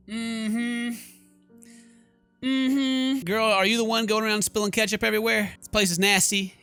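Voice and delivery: normal talking voice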